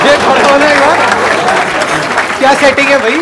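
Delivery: loud, high-pitched comic exclamation; exasperated playful shout; mock protest. Real, not acted. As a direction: cry out in amused disbelief over the laughter. Host mock-protests as audience laughs.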